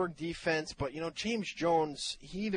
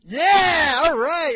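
much higher and louder, delighted whoop; thrilled; 12 semitones higher, 14 dB louder, much slower than usual. Real, not acted. Host delighted by caller's Australian accent, excited whoop. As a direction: cheer him on like a game show host.

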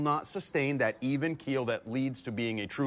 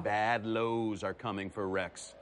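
low and slow; 7 semitones lower, slower than usual. Real, not acted. Drops to ominous after the highs.

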